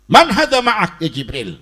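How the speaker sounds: loud, stern demand; tegas. Retold or imitated. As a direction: question it forcefully, like barking an order. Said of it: "tegas, iya". (bertanya tegas dengan suara keras)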